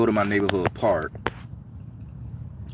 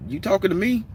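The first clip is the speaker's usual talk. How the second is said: pitched-up retold challenge, half mocking; confrontational challenge; 10 semitones higher than usual. A man challenging the stranger in the park, confrontational. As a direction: square up to the stranger, daring him. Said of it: "I say".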